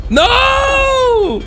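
sharp high yell of protest; shout. Real, not acted. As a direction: yell no at a teammate. Teammate grabbing his item.

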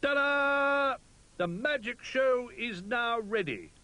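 high, loud showman's call; Rabbit calling out. Acted. Rabbit calling from behind the curtain, showy. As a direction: announce it like a stage magician. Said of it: "called Rabbit from behind the curtain".

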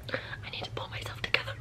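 quick, very soft whisper after crying; tearful whisper. Real, not acted. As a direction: whisper it, trying to recover from tears. Recovering from crying.

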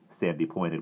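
ordinary delivery, nothing marked

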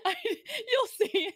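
slow words through laughter; giggling, barely holding it together; laughing. Real, not acted. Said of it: "What is she doing?".

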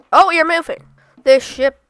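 sudden happy surprise; excited. Real, not acted. Ship suddenly starts moving.

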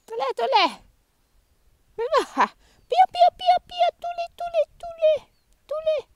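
very high, sing-song coaxing call; squeaky high doggy calls and praise. Real, not acted. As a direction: coo the dog toward you.

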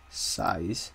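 ordinary talk, nothing marked

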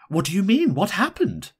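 high, sharp questioning. A narrator's reading. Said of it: "Missus Silsburn said sharply".

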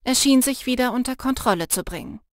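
ordinary delivery, nothing marked

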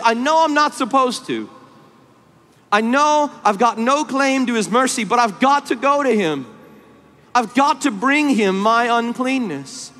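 high, urgent declaration in a preacher's voice; holy desperation. Retold or imitated. Afflicted sinner resolving to go to Jesus, desperately. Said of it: "have this holy desperation".

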